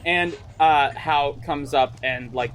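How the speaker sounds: ordinary talk, nothing marked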